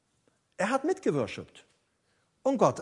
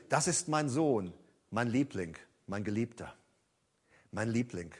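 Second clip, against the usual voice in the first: tender; 7 semitones lower, 8 dB quieter than usual. Shown as a demonstration. Preacher contrasts the flat translation with a tender, softer reading. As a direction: say it like a father cherishing his son. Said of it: "Aber da steht".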